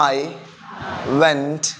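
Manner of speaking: I, went, there, each word apart with a long pause. Shown as a demonstration. Teacher dictates to the class for repeating, word by word. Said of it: "അങ്ങനെ പറയരുത്".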